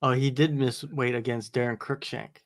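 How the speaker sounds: usual speaking voice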